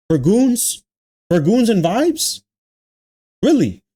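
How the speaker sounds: high, rising disbelief at each question; incredulous. Real, not acted. Ranting at the creator, high incredulous rising questions. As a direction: ask it like you can't believe it.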